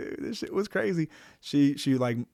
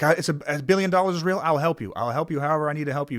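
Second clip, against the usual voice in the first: excited pleading; 5 semitones higher, 6 dB louder, faster than usual. Retold or imitated. Ian bursting in, pleading.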